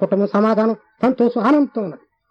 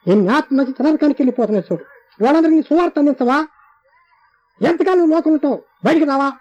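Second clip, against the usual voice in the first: higher, urgent pleading voice of the Lord; an imploring divine voice; pleading rebuke; 7 semitones higher than usual. Retold or imitated. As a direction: press him hard to come out and serve. The Lord's voice rebuking him.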